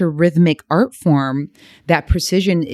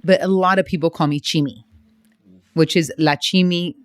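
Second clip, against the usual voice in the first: ordinary talk, nothing marked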